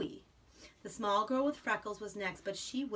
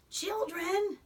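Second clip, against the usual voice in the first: high, louder startled question; surprised; 11 semitones higher, 7 dB louder than usual. Acted. School voiced by mother, startled at news. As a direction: gasp it out in surprise.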